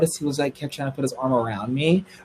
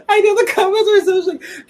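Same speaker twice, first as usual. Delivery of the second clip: much higher voice breaking into laughter; laughing; 5 dB louder than usual. Real, not acted. To viewers about their shocked comments, laughing high. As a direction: laugh through the words.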